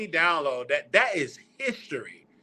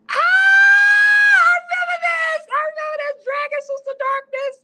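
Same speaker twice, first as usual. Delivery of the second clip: very high, squealing delight; real high-pitched thrill at a memory; excited squeal; 6 dB louder than usual. Real, not acted. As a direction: burst out in a loud squeal, then keep it high. Delighted at finding old episode.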